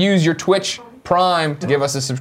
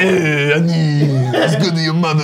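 a hint of the junk dealer voice, laughing; lightly voiced alien trader; half-laughing Watto impression; slower than usual. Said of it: "Do the Watto".

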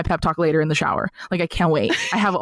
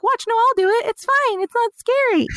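much higher, sing-song coaxing voice; reassuring parent. Retold or imitated. Parent coaxing a scared toddler toward Santa, high sing-song reassurance.

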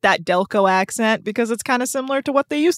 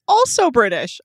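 ordinary talk

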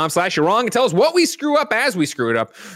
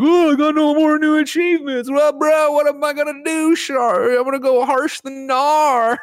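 very high, loud mock wailing; hammed-up snowboarder-bro lament in a shrieky high pitch; Snowbike Mike; 6 dB louder than usual. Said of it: "Snowbike Mike".

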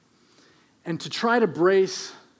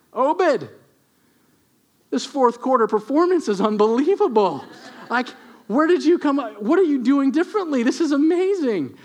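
higher, louder amazed praise; gushing boss; 8 semitones higher, 5 dB louder than usual. Acted. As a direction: rave like a thrilled manager. Boss raves about Obed's performance.

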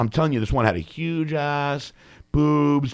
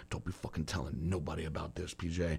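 hushed plea; 7 semitones lower, 15 dB quieter than usual. Retold or imitated. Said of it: "he goes".

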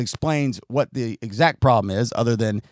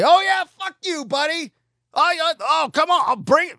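much higher, hammed-up taunting yell; squeaky mock tough-guy challenge; mock angry driver. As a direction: parody a hothead picking a fight. Mimicking aggressive driver yelling.

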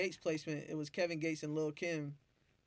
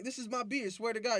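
higher, pleading insistence; insisting; 7 semitones higher than usual. Retold or imitated. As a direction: swear to it, worked up. Insisting to barber.

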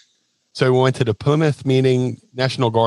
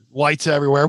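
usual speaking voice

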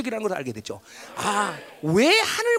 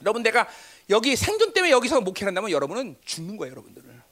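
ordinary talk

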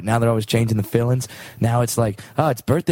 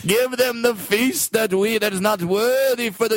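royal decree; 6 semitones higher than usual. Acted. King's grand orders.

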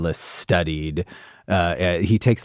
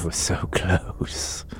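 whisper; 5 dB quieter, slower than usual. Real, not acted. Killed character laments.

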